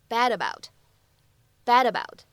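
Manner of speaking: "bad about" slowed down, d running straight into about; linked bad about. Shown as a demonstration. Teacher drilling the linking in "bad about" slowly. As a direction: link "bad about" as one smooth word. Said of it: "咱们可以做一个连读".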